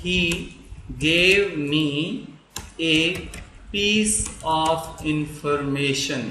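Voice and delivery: each word slow and spaced, with long pauses; slow dictation. Shown as a demonstration. Teacher dictating a sentence, slow and spaced. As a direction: spell it out for students to write.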